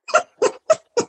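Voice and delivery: high burst of laughter; laughing at the story; big laugh. Real, not acted.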